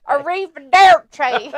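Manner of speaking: slow drawled arrivederci; Brad Pitt drawl. Retold or imitated. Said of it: "weird accent like that".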